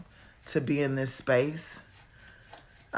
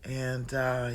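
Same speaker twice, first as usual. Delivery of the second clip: usual speaking voice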